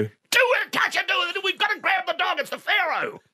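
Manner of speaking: frantic, very high mock yell; retold battlefield yelling. Father panicking son over the Pharaoh, frantic yelling. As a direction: yell as if in the middle of a war. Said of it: "in the middle of a war and he's yelling at you".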